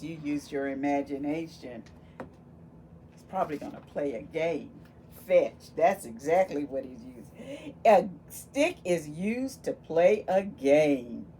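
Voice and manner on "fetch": ordinary delivery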